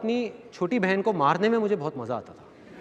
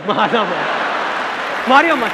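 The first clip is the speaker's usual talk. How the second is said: bystander egging on; 3 semitones higher, 4 dB louder, slower than usual. Retold or imitated. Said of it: "कहता है".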